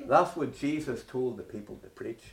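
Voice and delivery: ordinary delivery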